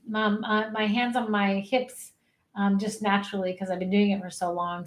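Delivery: normal talking voice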